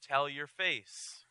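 drawn out and deadpan; wife teasing her husband, slowly; slow dry wife's line. Retold or imitated. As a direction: spell it out slowly for him. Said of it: "My wife has advised me".

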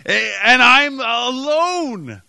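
drawn-out, high, loud mock lament; mock wail. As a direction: wail it slowly like an abandoned teen. Teenager stranded alone, dramatized wail.

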